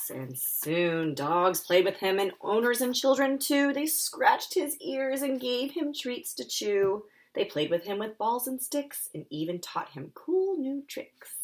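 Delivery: put-on lively picture-book reading for kids; animated storytelling voice; story narration. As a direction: read it like story time for little ones.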